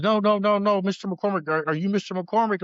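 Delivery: a policeman rushing to correct bad news; urgent officer. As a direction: cut in quickly to set things right.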